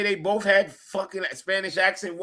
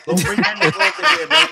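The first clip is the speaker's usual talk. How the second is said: louder plea; 7 semitones higher, 8 dB louder than usual. Retold or imitated. Pleading with Alonso.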